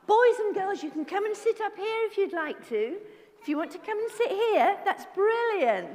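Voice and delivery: bright, high welcome; kids party host. Acted. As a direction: cheer the children in.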